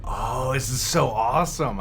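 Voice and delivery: ordinary delivery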